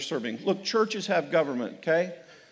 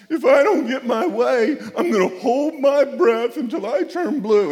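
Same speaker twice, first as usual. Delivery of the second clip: put-on whiny child; 7 semitones higher, 8 dB louder than usual. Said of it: "It'd be like".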